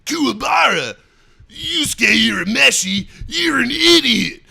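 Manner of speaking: high, loud anime character shout; trailer voice imitation. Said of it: "what voice I was kinda imitating".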